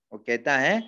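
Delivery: ordinary talk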